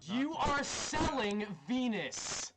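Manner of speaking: slow, high, hushed mock gasp of accusation. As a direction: draw out the accusation like a scandal.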